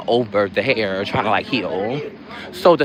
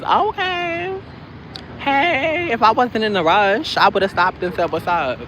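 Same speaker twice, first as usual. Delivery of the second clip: mock friendly hi; 10 semitones higher, slower than usual. Mock friendly greeting to passerby.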